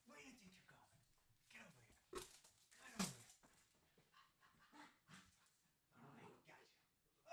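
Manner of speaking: high whispered coaxing; whispering to the dog. Real, not acted. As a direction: whisper as you grab the puppy. Catching the dog playfully.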